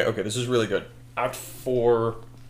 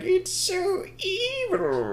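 very high, slow cartoon villain; hammed-up high squeal of evil glee; moustache-twirling villain; much slower than usual. Dick Dastardly gloating over his scheme, hammed-up villain voice. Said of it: "He's just twirling his mustache".

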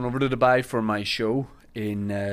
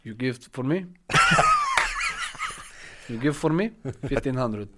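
mock Portuguese accent, broken English; a lightly done Portuguese-accented Mourinho haggling; playful fake Portuguese accent selling bags; Jose Mourinho impression; 4 dB quieter than usual. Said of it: "I'm doing Jose Mourinho".

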